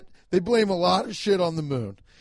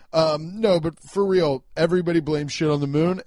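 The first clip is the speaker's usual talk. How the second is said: normal talking voice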